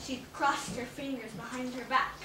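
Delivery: plain reading voice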